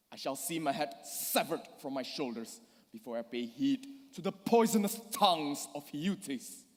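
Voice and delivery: normal talking voice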